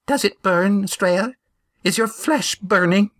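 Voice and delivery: high, searching voice of a stout widow; widow Amy. A narrator's reading. Widow testing Estrella for burning, high anxious voice.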